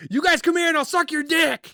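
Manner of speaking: high, mocking goofball voice; Joe Bugger impression. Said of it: "Or as Joe Bugger would say".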